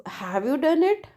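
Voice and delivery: DONE IT asked as a question; questioning rise. Shown as a demonstration. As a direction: lift the pitch on "have you done it" as a question. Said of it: "Indicating question".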